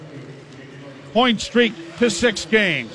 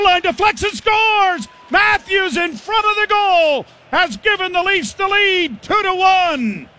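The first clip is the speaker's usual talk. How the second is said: excited goal call; 10 semitones higher, 4 dB louder than usual. Real, not acted. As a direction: belt out the goal.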